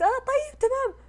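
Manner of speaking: high and loud, shaky okay; mock frightened okay; shaken reply. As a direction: agree while still panicked. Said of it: "قلت".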